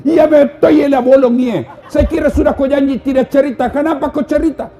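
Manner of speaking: high, loud accusing rant; angry husband. Retold or imitated. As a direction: scold the wife for telling. (suami marah menuduh istrinya, suara tinggi dan keras)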